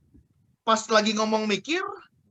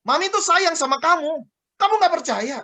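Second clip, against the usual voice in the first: raised, high-pitched acted reproach; mock exasperated mom, pitched up; scolding mother's tone; 7 semitones higher, 5 dB louder than usual. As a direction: insist on love in a loud, scolding tone. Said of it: "cuma nadanya".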